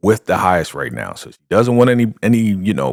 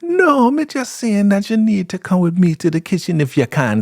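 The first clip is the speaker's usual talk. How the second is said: much higher, innocent reply; squeaky Elder Mother; 13 semitones higher than usual. Retold or imitated. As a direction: play dumb in a high voice. Elder Mother voiced answering Ruth.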